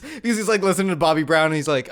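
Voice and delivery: ordinary talk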